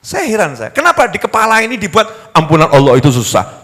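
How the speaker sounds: usual speaking voice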